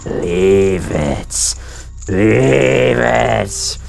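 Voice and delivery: slow, low and hollow undead groan; low mummy voice. Acted. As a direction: drag each word like a mummy. Reading Gibdo requests aloud.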